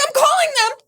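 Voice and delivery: mock panicky girlfriend voice; high mock whine. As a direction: squeak it like her. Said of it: "she was like".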